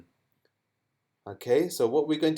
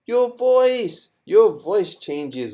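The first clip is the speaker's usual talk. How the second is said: Your voice said higher and louder, slow; emphatic answer; 9 semitones higher, 7 dB louder, slower than usual. Real, not acted. Teacher stressing the answer to the children. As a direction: hit "Your voice" hard and slow. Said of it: "stressing the key answer".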